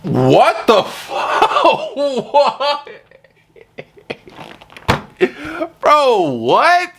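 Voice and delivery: slow, laughing "What the fuck?" rising high; laughing shock. Real, not acted. Laughing shock at wife's demand.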